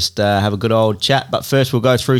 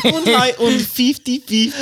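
high mock German accent; 13 semitones higher than usual. Host helping out with sponsor name, high-pitched joke German. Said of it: "You are German".

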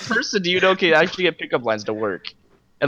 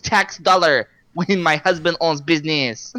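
mock Russian accent; 3 semitones higher than usual. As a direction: play a confused Russian woman haggling, hammed up. Mock Russian woman at dealership.

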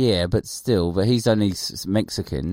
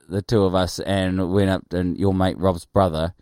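ordinary delivery

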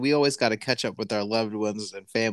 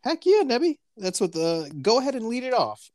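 high, bright cheer; excited; 10 semitones higher, 4 dB louder than usual. Real, not acted. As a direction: cheer him on, thrilled.

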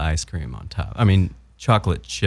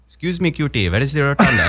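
higher, louder put-on Indian accent; 7 semitones higher, 3 dB louder than usual. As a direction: imitate the Indian accent people expect. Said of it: "You expect him to talk like that".

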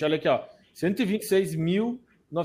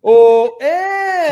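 excited shout; 10 semitones higher, 12 dB louder than usual. Real, not acted. Laughing banter on the live show.

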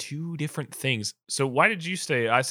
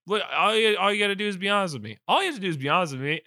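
rapid insistence; 5 semitones higher, much faster than usual. Retold or imitated. Stranger insists she be honest.

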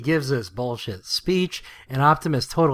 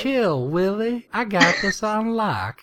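higher, slow and easygoing, like nothing's wrong; a relaxed higher drawled brush-off; laid-back mock Alpha Trion; 8 semitones higher than usual. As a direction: chill it out, unbothered. Alpha Trion acting loosey-goosey.

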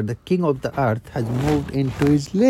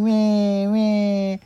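put-on high whiny baby cries; squealing cub whimper; crying cubs; 7 semitones higher, 3 dB louder than usual. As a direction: bleat like hurt little cubs. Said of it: "all the babies were crying".

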